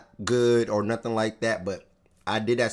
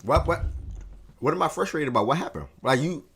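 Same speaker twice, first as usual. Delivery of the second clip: ordinary talk, nothing marked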